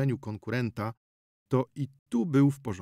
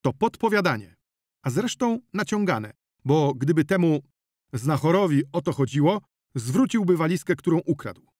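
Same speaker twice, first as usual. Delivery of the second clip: higher, louder, indignant; angry protest; 6 semitones higher, 6 dB louder than usual. Acted. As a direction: attack the question hard. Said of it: "zawołał doktor Pawlicki". (z oburzeniem, głośno)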